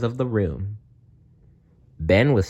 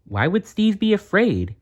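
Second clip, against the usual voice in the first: high disbelieving question; can't believe it, up high; a boy baffled that a bully could be scared; incredulous; 12 semitones higher than usual. Acted. Ben incredulous.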